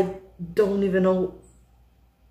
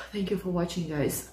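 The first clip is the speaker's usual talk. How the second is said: softer, sighing, drained sign-off; sighing thanks; 8 dB quieter than usual. Real, not acted. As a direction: breathe out and say it worn out.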